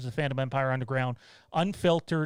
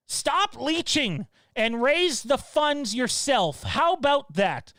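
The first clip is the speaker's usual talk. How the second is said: high, slow sneering mock of the commenter; 9 semitones higher, 3 dB louder, slower than usual. Streamer reads a troll's comment in a mocking voice. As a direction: read his words in a whiny high put-on voice. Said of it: "an interesting voice for him".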